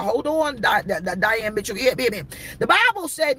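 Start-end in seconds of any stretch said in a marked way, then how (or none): none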